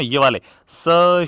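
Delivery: स stretched into a long, slow hiss; drawn-out hiss. Shown as a demonstration. Teacher demonstrating the hiss of स, slowly. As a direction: slowly draw out स as a hissing fricative. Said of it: "इनके बोलने के लिए आपको संघर्ष करना पड़ता है".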